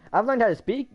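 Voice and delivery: slipping into a playful Irish accent. Shown as a demonstration. Gamer showing off his Irish accent to a friend. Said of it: "make an accent of Irish".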